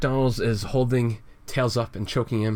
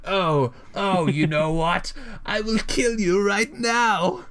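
high, hammed-up murderous threat; unhinged villain threat; 11 semitones higher, slower than usual. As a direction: snap into a shrieky, drawn-out mock threat. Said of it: "so he's getting there".

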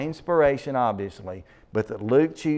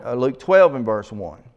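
ordinary delivery

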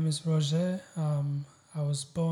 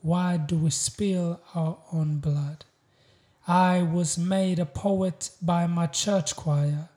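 slow, measured poetry recital, louder than talk; proud, weighty spoken-word delivery; spoken-word poem; 2 semitones higher, 5 dB louder, slower than usual. Acted. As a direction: declaim each line with deliberate pauses.

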